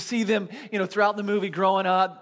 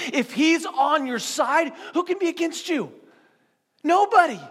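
preacher driving home that nobody can stand against God; preaching climax; 7 semitones higher than usual. Acted. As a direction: build it up then slam the answer.

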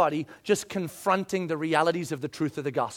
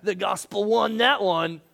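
smug gloat; slower than usual. Retold or imitated. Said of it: "you walk away going".

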